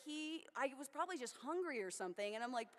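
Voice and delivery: ordinary talk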